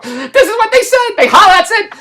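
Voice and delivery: very high, loud, strained-patience retort; exasperated insistence. Retold or imitated. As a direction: spell it out in mock exasperation. Friend insists in retold argument.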